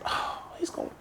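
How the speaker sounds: whispered aside. Retold or imitated. Student whispering worried aside about his brother. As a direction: breathe it under your breath. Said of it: "I said".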